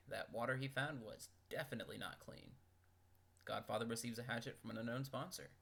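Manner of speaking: ordinary delivery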